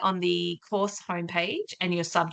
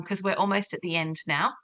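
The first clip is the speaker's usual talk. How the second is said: ordinary delivery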